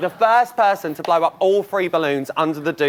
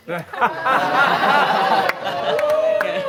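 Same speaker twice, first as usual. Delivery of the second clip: teasing laugh; 7 semitones higher than usual. Real, not acted. Host laughs at own tease.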